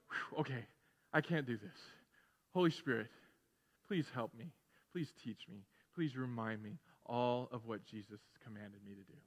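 low, soft, surrendering plea; sigh, then pray quietly and low, pausing between requests; humble prayer. Acted. Worn-out believer asking the Holy Spirit for help, humbly praying.

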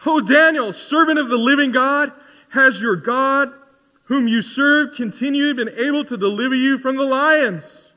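high, loud desperate call; the king crying out in anguish. A narrator's reading. King calling into the lions' den, anguished cry. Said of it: "he cried out in a tone of anguish".

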